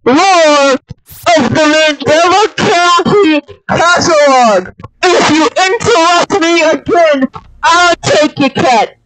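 loud, high, strained roaring voice; monster voice. Pretend. Demon voice roaring at the interrupting friend. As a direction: roar like a possessed demon. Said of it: "please speak English".

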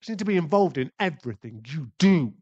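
angry accusation. Acted.

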